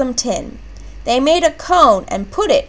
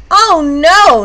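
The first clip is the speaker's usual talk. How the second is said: higher, louder startled shout; alarmed cry; 6 semitones higher, 6 dB louder than usual. A narrator's reading. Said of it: "Nana cried".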